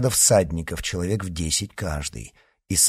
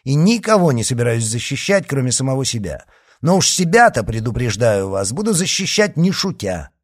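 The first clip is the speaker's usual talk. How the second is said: louder, higher, impatient retort; losing patience; 5 semitones higher, 5 dB louder than usual. A narrator's reading. D'Artagnan warns the leader he will fight, impatient. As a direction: let irritation rise into a warning. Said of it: "начиная терять терпение".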